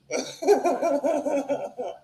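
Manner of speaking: high, sustained laugh; laughter. Real, not acted. Comic teasing friends in the back, high laughter. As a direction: break up laughing between lines.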